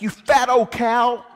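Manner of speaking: loud, slow, high yelled insult; shouted insult back. Retold or imitated. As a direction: yell it back at her, drawn out. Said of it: "he shouted back".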